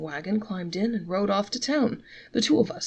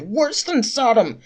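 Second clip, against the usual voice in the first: outraged shout; 2 semitones higher, 7 dB louder than usual. A narrator's reading. Traveler shouting at the innkeeper over the bill, loud. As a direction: bellow it out, scandalized. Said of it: "he cried".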